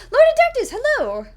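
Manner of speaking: high, loud hail to a friend; excited greeting. Real, not acted. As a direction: sing out a big, loud welcome. Hails an arriving viewer by name.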